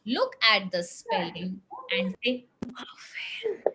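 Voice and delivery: whispered slowly. Shown as a demonstration. Teacher whispering to the class to read softly. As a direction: breathe the words, no voice. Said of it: "Now read it very slowly".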